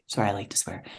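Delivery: whispered sheepish apology; whispered aside. Real, not acted. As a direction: drop your voice for a sheepish aside.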